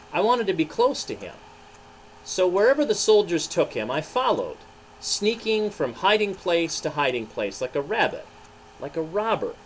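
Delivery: Peter telling his story. Acted. Pastor voicing Peter to children, ashamed and earnest. Peter's first-person monologue read.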